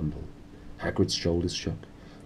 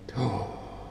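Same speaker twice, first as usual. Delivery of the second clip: mock gasp; 5 semitones higher, 8 dB quieter than usual. Dramatic gasp after chapter end.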